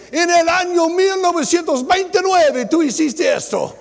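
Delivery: high, loud mimicry of a wife listing old faults; hammed-up nagging wife; mocking nagging wife. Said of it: "Pero ella dice".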